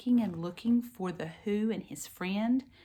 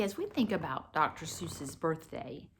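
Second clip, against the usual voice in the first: ordinary talk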